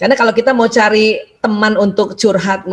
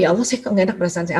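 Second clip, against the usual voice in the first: softer, fast worried murmur to God; hushed quick prayer; 4 semitones lower, 6 dB quieter, much faster than usual. Pretend. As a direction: confess the unease quietly and quickly. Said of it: "Jadi harus ngaku". (doa cepat dan pelan penuh cemas)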